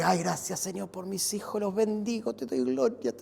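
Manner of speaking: mock grateful prayer. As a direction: play a believer thanking God for their kids. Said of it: "Estos hijos que". (oración de gracias suave, imitada)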